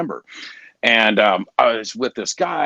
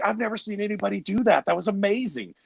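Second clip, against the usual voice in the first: high-pitched, awed praise; marvelling, kept high to the end; retold amazement; 9 semitones higher, 4 dB quieter than usual. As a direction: imitate an amazed boss, pitched up. Retells boss's amazed praise.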